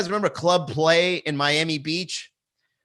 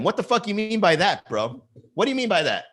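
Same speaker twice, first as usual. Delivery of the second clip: high-pitched, playfully offended challenge; mock indignation; 7 semitones higher than usual. Mock confronts the chat commenter.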